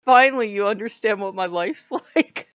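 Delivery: deadpan told-you-so with a laugh; sarcastic Odin. Retold or imitated. Odin needling owner about his life, loud and sarcastic. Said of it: "Odin goes".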